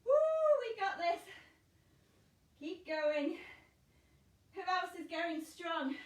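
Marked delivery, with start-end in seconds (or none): 0.0-1.2 loud high whoop of effort; excited whoop. Real, not acted. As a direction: cheer yourself through the burn. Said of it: "keep breathing".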